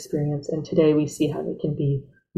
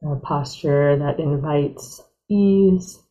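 guided meditation; 3 dB quieter than usual. Real, not acted. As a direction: ease them gently into stillness, unhurried.

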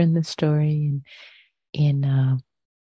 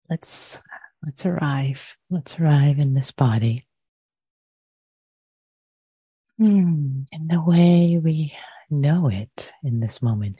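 guided meditation. Real, not acted. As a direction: guide listeners gently into the body, unhurried.

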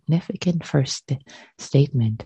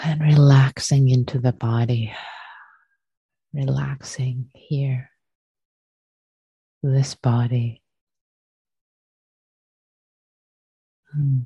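guided meditation. Acted. Teacher leading students to arrive and release, guided meditation. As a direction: guide them gently into the body, unhurried.

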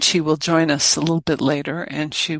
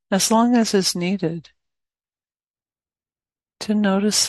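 guided meditation. Acted. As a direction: guide listeners gently into awareness, leaving plenty of space.

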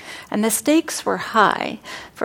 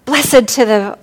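loud, startled slip of the tongue; startled loud blurt; 4 semitones higher, 10 dB louder than usual. Acted. Wife tripping with the meal, blurting loudly. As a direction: blurts out the wrong words after tripping. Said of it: "she says".

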